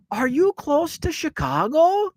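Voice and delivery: put-on very high, drawn-out Fargo accent. Fargo bartender quizzes Illinois visitors, in a high, slow Fargo accent. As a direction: imitate a Fargo bartender's rising question, slowly. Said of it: "That's exactly how they talk".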